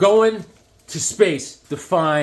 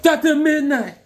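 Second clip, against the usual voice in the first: high, loud fan cry of the name; excited shout; 13 semitones higher, 5 dB louder than usual. Real, not acted. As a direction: burst out the name like a thrilled fan. Fan excitedly names hero.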